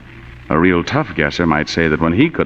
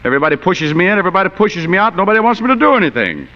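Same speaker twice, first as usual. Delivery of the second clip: exasperated outburst; 11 semitones higher than usual. Acted. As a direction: rant it, each line pushing higher.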